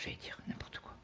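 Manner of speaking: quick whispered self-correction; whispered aside. Real, not acted. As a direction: drop to a whisper to shrug off the slip. Aside after slip of tongue.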